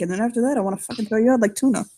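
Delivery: ordinary talk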